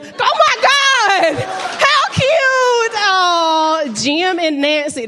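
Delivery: very high, loud, slow squeal of delight. Real, not acted. Comic to audience couple Jim and Nancy, squealing delight. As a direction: squeal as if it's the cutest thing ever.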